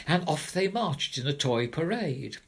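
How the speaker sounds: ordinary delivery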